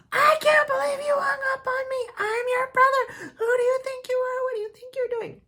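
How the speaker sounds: very high, loud outraged yell with a sob in it; yelling brother. Retold or imitated. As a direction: imitate the brother screaming after she hung up. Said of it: "He starts yelling".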